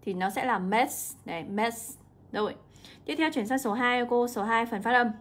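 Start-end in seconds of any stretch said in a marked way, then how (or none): 0.6-1.2 "mess" with just an s at the end; âm đuôi S. Shown as a demonstration. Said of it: "là âm S thôi". 1.4-2.0 "mess" ending on a plain s sound; âm đuôi S. Shown as a demonstration. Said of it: "là âm S thôi".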